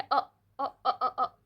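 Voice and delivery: अ said as a tiny clipped uh, with a laugh; repeated short schwa; short schwa demo. Shown as a demonstration. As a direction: chop each अ very short. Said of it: "और ये बहुत छोटी है".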